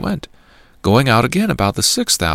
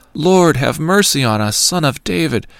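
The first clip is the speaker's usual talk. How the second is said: raised, pleading call for mercy; crying out; 6 semitones higher than usual. A narrator's reading. Blind men calling out to Jesus from the roadside, a raised plea. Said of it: "they cried out".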